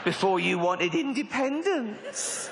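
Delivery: slow, drawn-out rebuke rising high at the end; sing-song comic reproach; mock scolding. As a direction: rub it in, stretching the final word upward. Scolding punchline on acted phone call.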